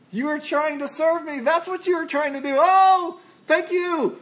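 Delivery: high, tender delighted praise; delighted Father. Retold or imitated. Father in heaven delighting in his children, high tender praise. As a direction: beam with delight at a child's gift. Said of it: "our Father sits in the heavens and goes".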